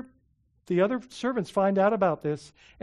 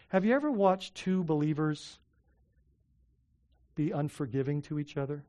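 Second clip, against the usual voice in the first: ordinary delivery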